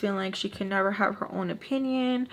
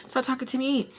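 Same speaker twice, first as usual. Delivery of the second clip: higher, voiced whine of annoyance; exasperated plea; 4 semitones higher than usual. Vlogger wishing the woman would stop asking her, exasperated whine. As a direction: plead aloud in a raised pitch.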